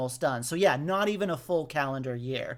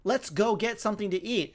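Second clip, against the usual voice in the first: quoting Pete; 6 semitones higher than usual. Retold or imitated. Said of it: "And then he goes".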